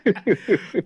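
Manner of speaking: loud, real helpless laughter; high, breaking into a big laugh; cracking up. Real, not acted. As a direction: laugh hard through the words. Cracking up at own story.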